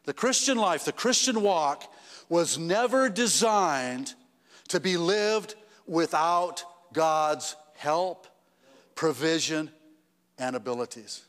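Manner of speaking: each phrase slowed and weighed; reading out his wife's saying to the church, slow and deliberate; slow quoted saying. Shown as a demonstration. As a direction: lay the wife's words down slowly, one by one. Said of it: "And this is what she said".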